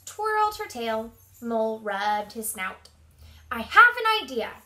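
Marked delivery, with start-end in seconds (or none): 3.5-4.6 high, loud mouse squeak; excited squeak. A narrator's reading. Mouse offering Mole an idea, squeaking loudly. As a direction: pipe up with a sudden bright idea. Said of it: "squeaked Mouse".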